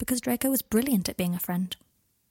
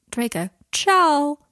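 drawn-out, showy "ciao"; pretentious ciao; 6 semitones higher, 9 dB louder, much slower than usual. A narrator's reading. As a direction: say "ciao" slowly and grandly, louder. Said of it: "Ciao is a wanky thing to say".

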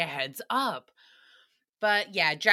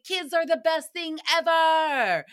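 higher, hammed-up gushing; mock gushing; 6 semitones higher, 3 dB louder than usual. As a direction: parody parents gushing, stretching the end. Mocks what parents tell others.

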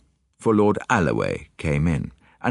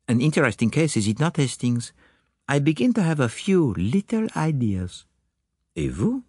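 put-on higher, accented detective voice; Poirot; 9 semitones higher than usual. Detective confiding little ideas to Hastings, higher accented voice. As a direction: play the musing detective, pitched up. Poirot's dialogue in narrated story.

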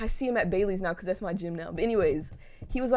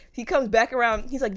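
ordinary delivery

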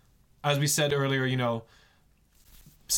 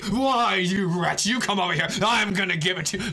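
loud, hammed-up angry outburst, louder and higher at the start; mock furious pope; 5 semitones higher, 5 dB louder than usual. Pretend pope threatening his chauffeur, hammed-up furious yell. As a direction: bark it like a cartoon villain, tongue in cheek. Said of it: "He was not like that at all".

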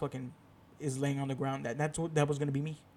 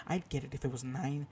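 ordinary talk

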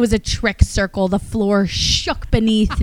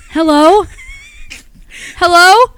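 starts a bit raised, ends in a high loud call; increasingly desperate hellos; 10 semitones higher, 9 dB louder, slower than usual. Retold or imitated. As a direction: call into a dying phone line, louder each time. Call dropping in dead zone.

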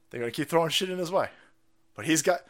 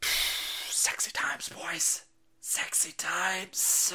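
sultry whisper; 3 semitones higher, 4 dB quieter, much slower than usual. Pretend. Streamer leering at the clip.

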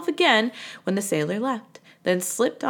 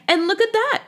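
high, louder cry of discovery; excited cry; 11 semitones higher, 5 dB louder than usual. Acted. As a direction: call out in sudden delight. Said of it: "cried Amos".

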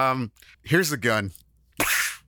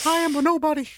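high dramatic cartoon voice; faster than usual.

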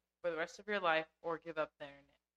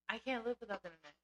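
ordinary talk

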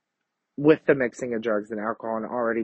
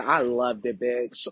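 usual speaking voice

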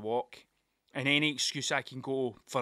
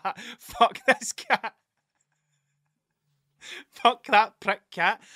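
slow, loud, high contempt; disgusted outburst; 6 semitones higher, 7 dB louder, much slower than usual. Retold or imitated. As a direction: spit it out slowly at the cat. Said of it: "And I'm like".

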